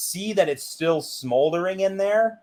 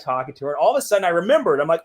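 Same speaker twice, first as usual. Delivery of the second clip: ordinary talk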